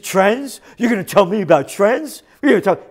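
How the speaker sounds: mocking disbelief. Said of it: "He said".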